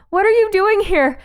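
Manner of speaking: high, loud surprise; shocked. Real, not acted. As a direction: blurt it out in alarm. Shocked by character appearing.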